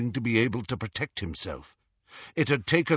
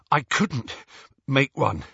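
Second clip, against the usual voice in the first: out of breath, words broken by gaps; panting; 4 semitones higher, 3 dB louder than usual. A narrator's reading. Harry back at the tent after fleeing, panting. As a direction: gasp it out in short pieces. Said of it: "he panted".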